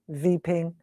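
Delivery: "weeping" said as "veeping"; soft "weeping" with V for W; German V for W. Retold or imitated. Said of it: "to weeping".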